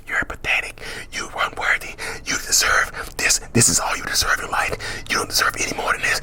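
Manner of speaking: soft unvoiced whisper of the inner critic. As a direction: whisper it like a voice in the head. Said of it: "that voice inside your head is whispering".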